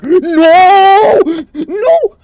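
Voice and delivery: high, loud frustrated no; real dismay, loud and high; rising cries of no. Real, not acted. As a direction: yell no as it all slips away. Frustrated at falling.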